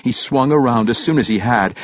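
plain reading voice

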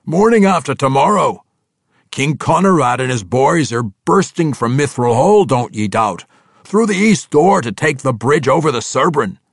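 hearty dwarf, pitched high and loud; excited dwarf telling the drow. Acted. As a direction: boom out the news like a brash dwarf.

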